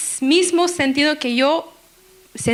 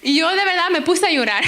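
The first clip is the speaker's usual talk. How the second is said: normal talking voice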